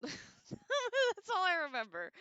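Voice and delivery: slow words through a real laugh; amused at yourself, laughing through the line. Real, not acted. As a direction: laugh it out, slowly. Laughing at own stoner memory.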